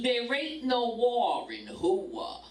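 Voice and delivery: high, slow Brooklyn retort; Brooklyn accent. Retold or imitated. Wife mimics husband disputing a spelling, in a Brooklyn accent. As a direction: imitate a husband arguing in drawn-out Brooklynese. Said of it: "that Brooklyn voice".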